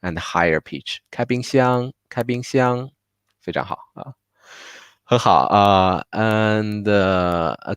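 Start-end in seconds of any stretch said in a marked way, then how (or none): 1.1-2.9 开冰箱 with 箱 long and high; Chinese tones demo. Shown as a demonstration. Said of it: "the 箱 is much longer and higher pitch".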